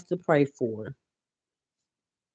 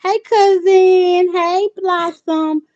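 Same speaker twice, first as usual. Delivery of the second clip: much higher, slow, drawn-out hello; high, slow, stretched greeting; singsong greeting; 7 dB louder, much slower than usual. Real, not acted. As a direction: call out to a cousin in the chat. Greeting viewers in the chat.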